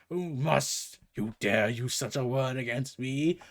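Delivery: usual speaking voice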